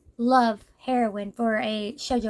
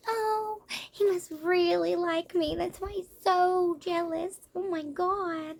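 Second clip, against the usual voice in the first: put-on higher, softer dreamy girl voice; mock-naive infatuated sigh; smitten girl; 5 semitones higher, 4 dB quieter, slower than usual. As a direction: swoon over him like a lovestruck girl. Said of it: "She's just like".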